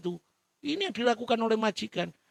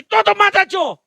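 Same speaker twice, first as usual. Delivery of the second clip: high, loud command to lie flat; master's loud order; 13 semitones higher, 12 dB louder than usual. Acted. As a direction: bark it as a demand. (perintah keras majikan)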